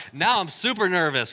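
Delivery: ordinary talk, nothing marked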